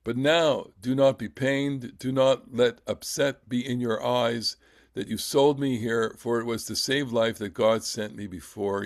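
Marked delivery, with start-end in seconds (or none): none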